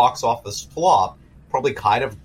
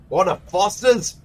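lightly Australian offer; a hint of an Aussie bloke; mock Aussie accent; 5 semitones higher than usual. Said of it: "I don't know what that voice was".

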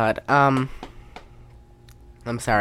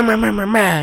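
usual speaking voice

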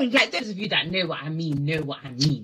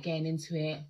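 ordinary delivery

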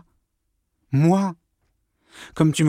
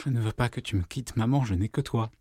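soft plea; 8 dB quieter than usual. Acted. Son pleads with mother.

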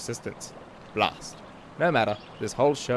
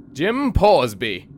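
slow, loud, drawn-out name reveal; dramatic reveal; 4 semitones higher, 8 dB louder, much slower than usual. Acted. Narrator unveiling his nemesis, slow and loud. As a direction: announce the villain's name like a thunderclap.

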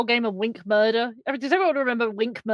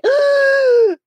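excited gasp; 13 semitones higher, 8 dB louder than usual. Real, not acted. Gasp of delight.

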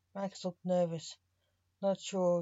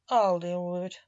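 louder, decisive promise; firm and loud; 7 dB louder than usual. Acted. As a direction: settle it firmly.

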